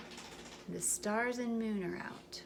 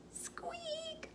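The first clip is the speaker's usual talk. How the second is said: tiny high squeaky mouse noise; mouse squeak; 11 dB quieter than usual. Acted.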